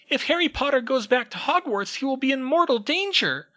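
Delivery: very high, loud squeaky elf voice, urgent; a tiny servant pleading a warning; anxious house elf. Acted. House elf warns Harry, squeaky and urgent. Dobby's voice held.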